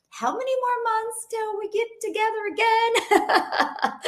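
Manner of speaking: high-pitched, playful whine breaking into laughter; mock-plaintive wail. Acts out missing departed friends.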